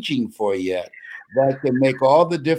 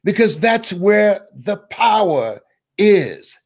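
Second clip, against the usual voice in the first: rising sermon; 4 semitones higher than usual. Acted. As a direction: lift them up like a preacher. Preaches to the audience.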